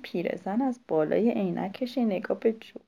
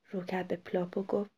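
usual speaking voice

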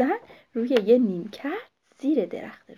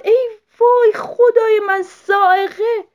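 put-on high, loud panicked cry of an old woman; shout; 12 semitones higher, 14 dB louder, slower than usual. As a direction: scream it out as if lightning struck. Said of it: "یهویی داد زد".

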